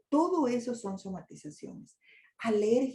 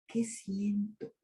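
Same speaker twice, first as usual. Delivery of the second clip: whispered self-question; 5 dB quieter, much slower than usual. Pretend. Inner question to oneself.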